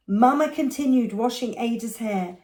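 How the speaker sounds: ordinary delivery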